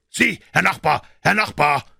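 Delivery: high, loud call to a neighbour; shouting. A narrator's reading. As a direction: call out after someone passing by. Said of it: "schrie Aloysius".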